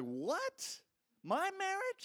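a shocked rising what, my marriage; indignant surprise. Retold or imitated. Executive acting surprised at being told to fix his marriage. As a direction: voice jumps way up on marriage in disbelief.

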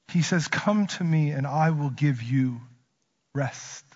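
a hint of God speaking tenderly; tender promise. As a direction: comfort them gently. Voicing God's promises tenderly.

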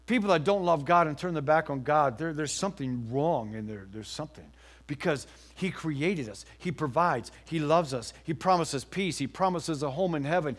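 ordinary talk

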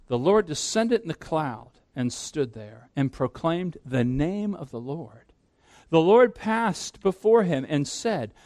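slow, weighty reading of the passage; solemn scripture reading. Acted. Preacher reading Exodus to the congregation, slow and solemn. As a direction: read it out slowly as holy words.

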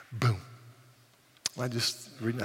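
normal talking voice

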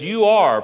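ARE said high and loud; stress on are. Shown as a demonstration. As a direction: punch the present tense are. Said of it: "but he says".

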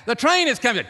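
high, loud warning yell; frantic shout. Retold or imitated. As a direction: scream it like a train is bearing down. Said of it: "started shouting in your window".

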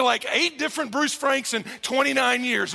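ordinary talk

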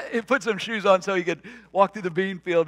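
laughing through the line at the hunter's undies; chuckling while telling it. Real, not acted. Preacher telling the congregation the story, laughing.

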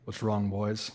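low, soft Southern man; deep, understated Southern voice. Retold or imitated. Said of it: "like, deep Southern voice".

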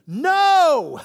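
sudden high shouted no; scream at the TV. Retold or imitated. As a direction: yell it at the screen in protest. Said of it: "I want to scream at the T V".

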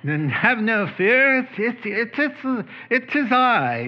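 a grand theatrical reading of the line; Shakespearean actor. Retold or imitated. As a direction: declaim it like a pompous Shakespearean actor. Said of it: "like a Shakespearean actor".

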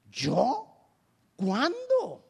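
mock surprise, pitch leaping up; surprised. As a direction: play the baffled, wrongly accused innocent. Acts out accused person caught unaware.

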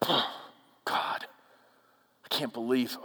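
sigh, then a breathy whisper, slow and fed up; exasperated sigh. Retold or imitated. Preacher voicing a believer fed up with God, sighing whisper. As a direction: huff it out like a sulking believer. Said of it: "huff and puff at God".

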